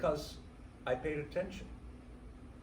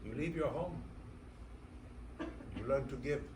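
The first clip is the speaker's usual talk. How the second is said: ordinary talk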